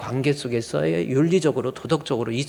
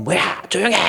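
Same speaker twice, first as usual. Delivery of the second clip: husband's raised, irritated snap at his wife; mock angry husband; 5 semitones higher, 3 dB louder than usual. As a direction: bark it like a short-tempered husband. (아내에게 버럭하는 남편 흉내)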